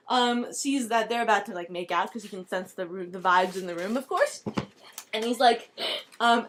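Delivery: ordinary delivery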